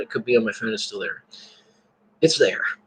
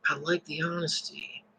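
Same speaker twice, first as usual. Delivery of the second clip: "I like the honesty" said again much softer; soft repeat; 13 dB quieter than usual. Real, not acted. Preacher repeating to his class, much softer. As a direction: drop to a soft, sincere echo. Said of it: "I, I like the honesty".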